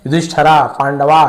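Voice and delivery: युधिष्ठरा, पांडवा with long final aa; Anglicised युधिष्ठरा, पांडवा, ending open; English-style endings. Retold or imitated. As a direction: tack an aa onto युधिष्ठरा and पांडवा. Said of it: "ऐसे कर देते हैं".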